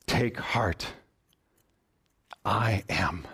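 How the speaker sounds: very low, measured declaration; solemn Jesus. Acted. Preacher answering who the voice of truth is, solemn and low. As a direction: let each phrase settle deep and still.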